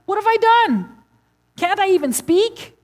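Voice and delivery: protesting David. Retold or imitated. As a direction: cry out as the wronged little brother.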